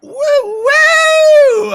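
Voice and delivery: high, loud forced whoop; forced hype cheer. Real, not acted. Tired streamer forcing hype for chat, loud high whoop. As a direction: whoop hard to fake energy. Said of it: "I'm trying".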